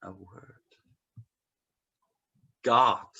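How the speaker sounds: ordinary delivery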